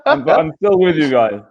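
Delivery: talking through a mouthful; words round a bite of food; crunching chips. Real, not acted. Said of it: "You're eating".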